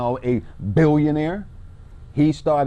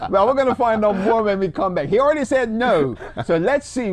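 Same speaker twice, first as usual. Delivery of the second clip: laughing; 8 semitones higher, 3 dB louder than usual. Real, not acted. Host laughing going to break.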